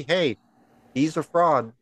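ordinary talk

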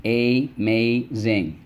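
"amazing" stretched slowly as a-maz-ing; syllable by syllable. Shown as a demonstration. Teacher reviewing words with the class, slowly syllable by syllable. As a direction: tap "amazing" out slowly, one syllable per finger. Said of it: "Let's go".

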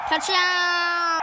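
loud, drawn-out scoring call; touchdown shout. Real, not acted. Celebrating a score in the game.

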